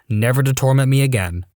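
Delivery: ordinary delivery, nothing marked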